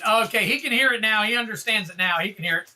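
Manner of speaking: normal talking voice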